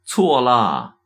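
high, loud shout from behind; calling out. A narrator's reading. Master shouts after his departing disciple, loud high call. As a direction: shout it after someone walking away. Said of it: "维山却在后面喊道".

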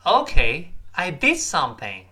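loud rising Okay, then DID stressed and falling; "Okay" up, "I DID something" stepping down. Shown as a demonstration. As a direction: model the line with a punched "did". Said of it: "注意语音、语调、轻重音的变化".

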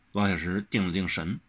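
plain reading voice

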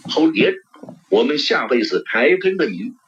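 usual speaking voice

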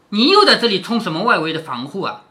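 normal talking voice

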